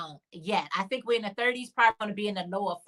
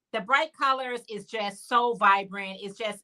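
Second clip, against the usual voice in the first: usual speaking voice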